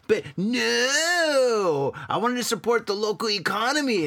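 high, long-drawn mock whine on "no"; mock your own good intentions, pitch swooping up; exaggerated self-mockery; self-mocking regret. Mocks his own choice of beer.

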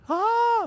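high sustained ah; a hammed-up tongues-style cry; mock Hebrew. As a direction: sing out a high joking ah. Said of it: "let me say that in the Hebrew".

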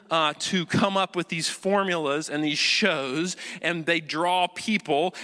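usual speaking voice

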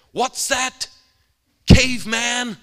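high, very loud jeer, imitated; mock shouted taunt. Street preacher challenges the bearded stranger, shouting mockingly. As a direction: hurl it across the street as a taunt. Heckler's insult in retold quarrel.

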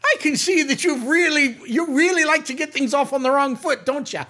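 high, scolding keeper voice; indignant matron. Acted. Matron scolding a rude visitor, indignant.

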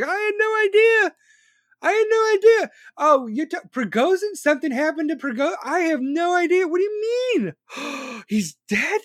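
high-pitched mock shock and denial; Vladimir Putin. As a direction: squeal in fake innocence, feigning total surprise. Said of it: "Like, Putin's in shock".

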